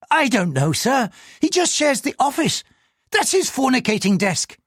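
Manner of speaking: high, anxious pleading voice; a frightened man answering the police; nervous clerk. Acted. Nervous clerk answers the superintendent in a high, anxious voice.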